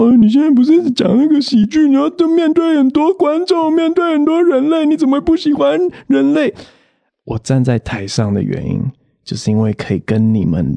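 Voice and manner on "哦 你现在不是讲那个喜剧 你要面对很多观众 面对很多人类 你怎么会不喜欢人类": high, incredulous mock questioner; baffled others. People asking the comedian why he hates humans, high and incredulous. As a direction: pitch up in disbelief. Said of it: "别人就会讲说".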